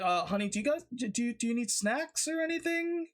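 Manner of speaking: high, nervous voice peeking in; timid crewmate. Acted. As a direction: ask sheepishly from the doorway. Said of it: "he's just like".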